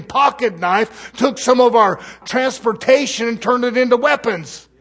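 loud, driving preacher's cry; full-force pulpit preaching; fiery preaching. Acted. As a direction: preach it hard to the congregation.

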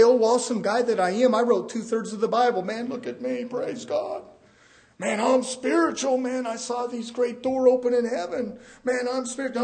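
hammed-up bragging apostle; mock boastful. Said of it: "you'd never heard him talk like that".

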